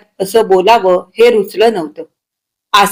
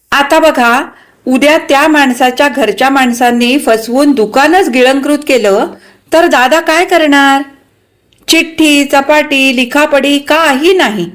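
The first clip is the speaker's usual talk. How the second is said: higher, insistent character voice; forthright wife, worried and indignant; 5 semitones higher than usual. Acted. Wife warning about a deal without paperwork, indignant. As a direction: argue the risk point by point.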